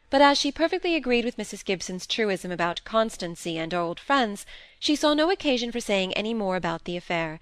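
usual speaking voice

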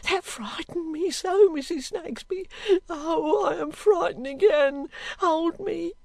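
put-on high, tearful panicking servant girl; frightened cry. A narrator's reading. Girl clinging to Missus Snagsby, terrified. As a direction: wail it out, begging for comfort. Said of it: "cried the girl".

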